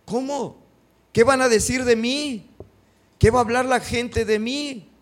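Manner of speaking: high, loud indignant protest; hammed-up cry of "what will people say"; mock alarmed refusal. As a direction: play a young woman horrified at the gossip. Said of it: "Ella no dice".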